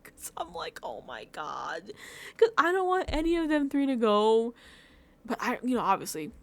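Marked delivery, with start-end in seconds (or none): 0.8-1.9 very soft, slow, low groan; hushed dismay, drawn out slowly; quiet, heartsick murmur; upset reaction. Retold or imitated. Recap of reacting in tears.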